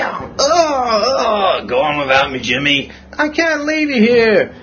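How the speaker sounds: hammed-up wounded soldier; mock dramatic battlefield farewell; dying soldier. Acted dying soldier scene.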